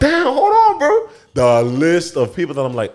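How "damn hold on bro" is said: surprised laugh. Retold or imitated. Exasperated realization, laughing.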